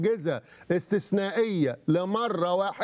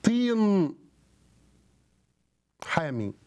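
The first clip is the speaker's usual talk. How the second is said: put-on slow, solemn recitation of the old text, pitched up; reciting a scripture quote; 4 semitones higher, much slower than usual. TV host quoting one tradition in a slow, solemn recitation. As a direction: intone it like reading from a holy book. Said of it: "وقول آخر".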